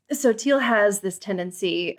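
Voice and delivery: ordinary talk, nothing marked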